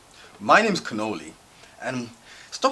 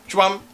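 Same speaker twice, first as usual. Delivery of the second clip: loud, high "chwam" sound; imitated snatch, high and loud; grab sound effect; 8 semitones higher, 5 dB louder than usual. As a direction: punch out a sudden grabbing noise.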